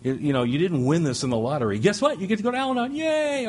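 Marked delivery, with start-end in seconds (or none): 1.8-3.5 much higher, hammed-up fake excitement; mock cheer. Speaker mocking newcomers' joy at starting Al-Anon, sarcastic high cheer. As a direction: announce it like a prize you didn't want.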